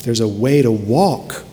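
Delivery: ordinary talk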